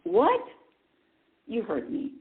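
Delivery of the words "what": high, loud mock shock; incredulous. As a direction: blurt it out like a stunned listener. Acts out audience's shocked reply.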